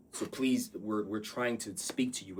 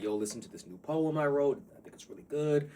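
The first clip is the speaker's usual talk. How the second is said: excited friend; 7 semitones higher, 4 dB louder than usual. Retold or imitated.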